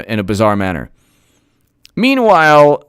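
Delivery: ordinary talk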